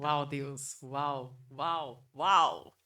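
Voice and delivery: building wows of real amazement; amazed. Real, not acted. Host reacting to the guest's liberating scream story, rising amazed wows.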